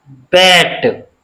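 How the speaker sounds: "बैट" slowly, with an open a as in cat; vowel demonstration. Shown as a demonstration. Teacher shows the double-matra sound, "बैट" slow and open-vowelled. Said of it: "दो मात्राओं से".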